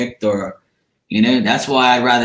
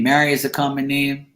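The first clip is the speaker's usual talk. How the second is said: ordinary delivery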